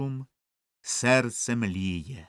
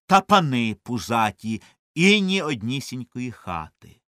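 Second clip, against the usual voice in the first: plain reading voice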